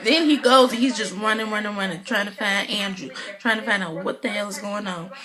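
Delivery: usual speaking voice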